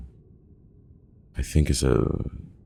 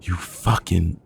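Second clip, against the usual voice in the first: higher-pitched furious curse, cut off; angry outburst; 6 semitones higher than usual. A narrator's reading. John lashing out after being set off, angry. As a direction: curse in rising anger. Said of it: "seems to have set off John".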